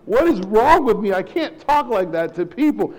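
acted-out dismay at yourself; exasperated self-scolding. Principal scolds himself for echoing people's accents, exasperated.

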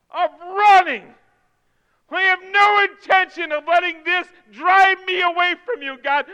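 high, loud pleading shout; crying out to God. Acted. Psalmist pleading with God while slipping, loud desperate cry. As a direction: scream it out like you're falling. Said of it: "You just need to scream it out".

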